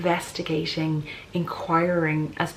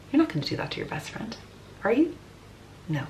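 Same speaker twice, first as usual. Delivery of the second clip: usual speaking voice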